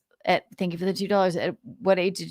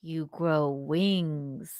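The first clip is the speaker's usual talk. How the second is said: slow, softer punchline; lightly imitated dad, slow deadpan; 4 semitones lower, 7 dB quieter, much slower than usual. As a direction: drag it out dry like a dad. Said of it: "they're laughing".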